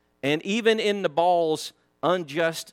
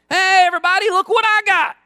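much higher, louder cocky call across the camp; hollering from afar; 11 semitones higher, 7 dB louder than usual. Retold or imitated. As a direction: holler it out to the whole camp, taunting. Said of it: "he starts hollering at everybody".

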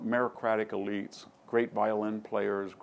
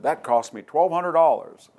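loud, raised-pitch mock grumble; retold loud complaint; 6 semitones higher, 9 dB louder than usual. Trump comes back to his golf partner, loudly complaining. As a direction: act out a golfer protesting the expense.

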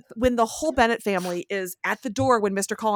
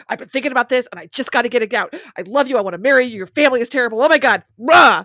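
fast, louder blurted rush building to a roar; torrent; 2 semitones higher, 7 dB louder, faster than usual. Retold or imitated. Imitating Darcy's rushed proposal, building to a roar. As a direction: spill it all out in one breath. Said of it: "that initial just torrent of".

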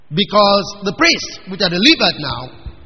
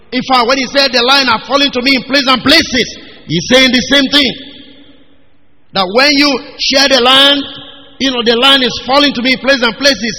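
louder, raised sermon voice driving the point; fervent preaching; 4 semitones higher, 5 dB louder than usual. Acted. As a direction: preach it out to the congregation with fire. Preaching at full force.

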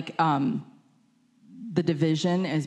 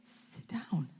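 teacher's quiet order; 2 semitones higher, 13 dB quieter than usual. Retold or imitated. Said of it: "And he's like".

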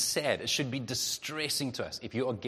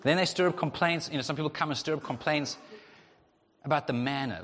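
usual speaking voice

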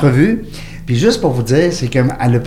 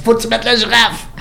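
imitating her insistent demand, pitched much higher; Isabelle's insistence; 10 semitones higher than usual. Host imitates Isabelle insisting on the giraffe, pitched high. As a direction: squeak it out like a friend who won't let it go. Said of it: "elle dit".

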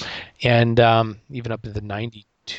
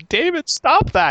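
much higher, loud, slow exasperated shout; frustrated mom; 7 dB louder, slower than usual. Retold or imitated. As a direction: scold a husband who won't quit. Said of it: "my mom got really frustrated at him".

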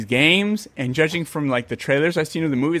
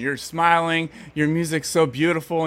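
usual speaking voice

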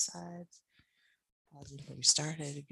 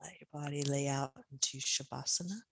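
guided meditation. Acted. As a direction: settle them into rest gently. Guides Shavasana relaxation.

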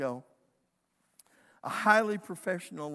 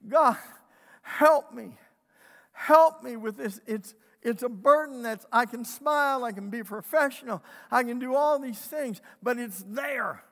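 a touch louder, pleading retelling of a prayer; retold cry for help; 5 semitones higher, 8 dB louder than usual. Friend retelling her prayer over her stony heart, mild pleading cry. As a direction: echo her desperate plea to God, lightly. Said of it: "I cried out".

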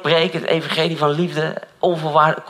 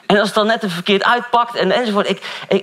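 preaching; 7 semitones higher than usual. Acted. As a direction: drive the point home from the pulpit. Preaching voice rises again.

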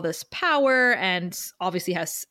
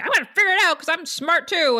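put-on high, loud, fast bratty teen; mouthy teenager; 8 semitones higher, 4 dB louder, faster than usual. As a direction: rattle it off like a smug know-it-all kid. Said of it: "a mouthy teenager".

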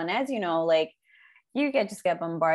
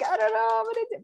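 panicked; faster than usual. Retold or imitated. Retold despair at midnight.

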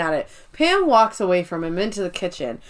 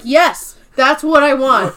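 angry; 3 semitones higher, 7 dB louder than usual. Retold or imitated. Pam's angry reply.

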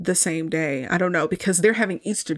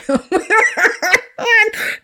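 high, louder burst of laughing; real laughter; 13 semitones higher, 5 dB louder than usual. Real, not acted. After the who-gives-a-damn quote, loud high laugh.

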